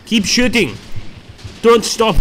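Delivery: rally the troops in a mock South African accent. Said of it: "I'm doing my best South African accent".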